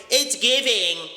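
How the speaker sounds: high-pitched mocking slang quote. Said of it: "you think I'ma say".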